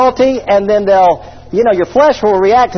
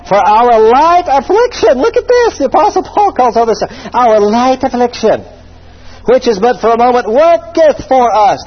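scripture reading; 4 semitones higher than usual. Acted. Reads scripture aloud.

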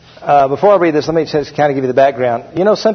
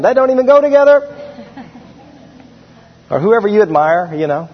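ordinary delivery

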